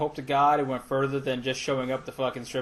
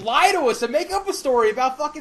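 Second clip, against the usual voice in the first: higher, louder, laughing demand; laughing plea; 8 semitones higher, 6 dB louder, faster than usual. Real, not acted. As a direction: beg for the juicy version, laughing. Begging for a better story.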